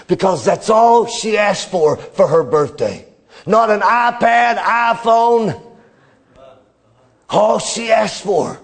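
preacher telling a story to the church, full voice; preaching. Acted. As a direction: preach it out to the congregation with building fervour.

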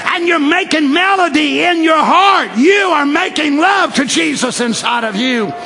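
high-pitched shouted exhortation; full-force preaching. Real, not acted. Preacher rouses congregation about singing their faith, shouting.

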